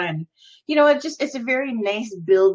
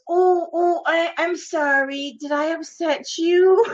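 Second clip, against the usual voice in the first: high, drawn-out mock apology; husband's put-on simpleton voice; 7 semitones higher than usual. Wife imitating husband's fake apology in public, put-on childish voice. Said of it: "act like a special ed person".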